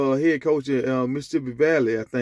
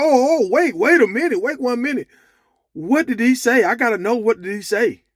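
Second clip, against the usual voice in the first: high-pitched, louder mock panic; frantic protest; 9 semitones higher, 4 dB louder than usual. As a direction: imitate someone urgently demanding to know, voice jumping high.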